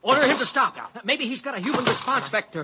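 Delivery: panicked. Acted. Crew panics at the robot.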